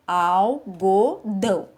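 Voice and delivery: ALGODÃO in three slow beats, "al - go - dão"; dividido em sílabas. Shown as a demonstration. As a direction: say algodão syllable by syllable, with pauses. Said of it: "quantas partes tem a palavra". (algodão separado em sílabas, al... go... dão)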